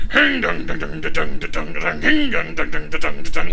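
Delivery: loud vocal guitar shred; guitar riff sounds. Pretend. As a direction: belt out a metal riff with your mouth. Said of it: "a guy on top of the mech just shredding guitar".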